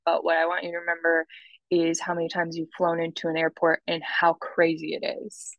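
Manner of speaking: ordinary delivery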